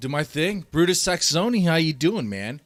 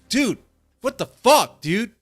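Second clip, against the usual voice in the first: loud, high disbelief; outraged exclamation; 7 semitones higher, 6 dB louder, slower than usual. Real, not acted. Streamer venting to chat about the coach, outraged exclamation. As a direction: throw it out in real outrage.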